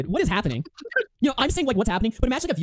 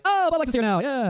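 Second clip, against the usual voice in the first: high, hammed-up welcome; mock excitement; 5 semitones higher, slower than usual. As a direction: imitate a thrilled host greeting a guest. Mocking a fawning welcome.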